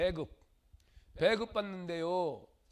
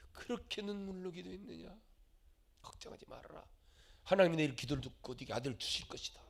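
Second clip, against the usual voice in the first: lower, hushed comforting voice; soft reassurance; 4 semitones lower, 5 dB quieter than usual. Acted. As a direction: soothe her gently and low. Eli comforts Hannah softly.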